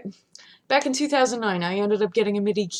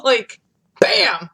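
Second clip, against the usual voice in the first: normal talking voice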